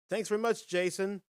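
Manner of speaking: ordinary talk, nothing marked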